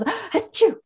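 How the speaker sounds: high, sharp mock sneeze; imitated sneeze. Clerk scanning groceries suddenly sneezes, mock sneeze. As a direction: fake a quick sneeze. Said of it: "all of a sudden he goes".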